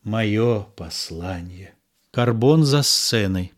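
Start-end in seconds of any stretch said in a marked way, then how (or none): 0.0-1.7 slow, much softer private aside. A narrator's reading. Cyrano's bitter aside about his own letter, soft. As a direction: say it only to yourself. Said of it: "Сирано в сторону".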